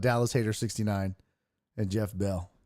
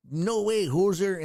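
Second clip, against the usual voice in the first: mock Canadian accent, higher and punchy; Canadian hoser voice; 6 semitones higher than usual. Canadian host mocking amateur hockey players, in a hammed-up Canadian accent. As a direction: tease like a Strange Brew hoser. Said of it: "Strange Brew".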